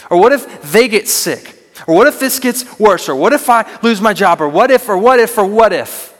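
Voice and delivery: high, anxious, rushing worry; fearful what-ifs. Retold or imitated. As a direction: voice the spiraling fear in your head. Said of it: "It says".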